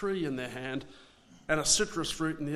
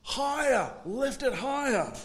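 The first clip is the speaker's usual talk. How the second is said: mock crowd call, higher and a bit louder; 8 semitones higher, 3 dB louder than usual. Worshippers urge the priest at the pool, raised call. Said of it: "the people would call out".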